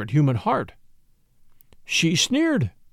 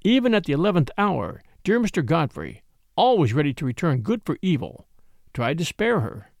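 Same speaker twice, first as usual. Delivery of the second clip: ordinary delivery, nothing marked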